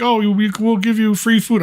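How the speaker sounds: placating manager. Retold or imitated. Manager trying to placate them.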